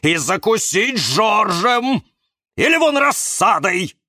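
high, loud, indignant tirade; barking rant. A narrator's reading. Cactus warns that Валера will bite everyone, high angry rant. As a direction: rage like an outraged little cactus. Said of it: "рявкнул кактус Кирилл и топнул ногой".

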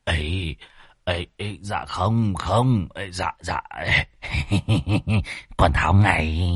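soft, whimpering stammer of denial; flustered, frightened. Acted. Exorcist hurriedly obeying the underworld demon, flustered and cringing. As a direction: cower and stutter out a nervous laugh. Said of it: "Môn Phọt vội vàng xua tay".

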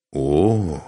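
long, lingering "О"; stretched-out exclamation; drawn out. A narrator's reading. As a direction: draw the sound out appreciatively. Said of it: "протянул Машек".

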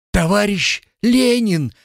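put-on high, fading cry of a dying boy; faint cry. A narrator's reading. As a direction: cry out with failing strength. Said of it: "вскрикнул Мишка глохнущим голоском".

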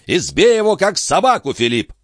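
high-pitched furious command; old baron's high-voiced bellow; angry roar. A narrator's reading. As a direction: order it high and irate. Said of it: "проревел барон".

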